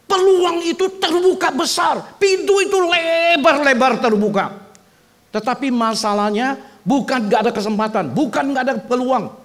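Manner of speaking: loud high ringing proclamation; full-force preaching. Acted. Pastor urging the church to seize chances, shouted preaching. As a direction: throw the doors wide open with the voice.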